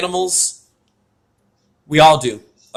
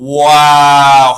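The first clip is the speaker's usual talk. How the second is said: loud stunned wow; 10 dB louder than usual. Real, not acted. Reacts to cat story.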